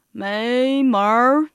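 loud, long-drawn refusal; elephant's loud, stubborn no; defiant shout. Acted. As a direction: shout it out, stretching each syllable.

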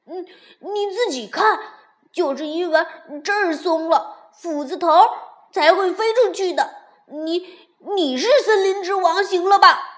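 loud, high angry yelling; furious. A narrator's reading. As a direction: shout back in rage. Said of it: "跳跳气得跳了起来".

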